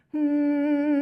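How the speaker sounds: loud hum resonating in the throat. Shown as a demonstration. Teacher humming into the throat for the class. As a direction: hold one hummed note in the throat. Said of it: "Let's do that again in the throat".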